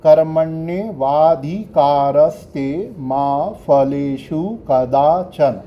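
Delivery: teacher articulating every akshara of the shloka; careful Sanskrit pronunciation. Shown as a demonstration. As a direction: spell out each sound of कर्मण्येवाधिकारस्ते slowly and deliberately. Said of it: "मैं आपको अक्षर पढ़ाता हूँ ना".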